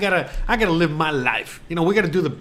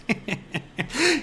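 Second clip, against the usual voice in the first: high, soft laugh; short amused laugh; real laugh; 6 semitones higher, 6 dB quieter than usual. Real, not acted. Laughing at what his wife said, softly. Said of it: "burst out laughing".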